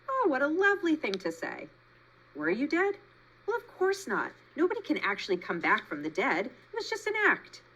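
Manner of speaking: high, theatrical possum voice; flattered possum. A narrator's reading. Possum explains her death act to Roz, high and theatrical.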